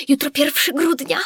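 high, loud excited call. A narrator's reading. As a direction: announce it bursting with excitement. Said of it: "zawołała podekscytowana". (podekscytowane wołanie)

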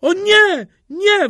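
very high, loud cry; high-pitched terrified calling; panicked wail. A narrator's reading. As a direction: wail in desperate protest. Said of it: "wołał Kościelny".

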